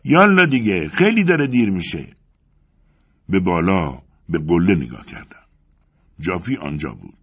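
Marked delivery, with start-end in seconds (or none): 0.0-2.1 urgent high shout to someone far below; calling out. A narrator's reading. Climber higher up hurrying his friend along, shouting. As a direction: holler down the mountain, loud and high. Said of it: "جافی از صد پا بالاتر داد زد".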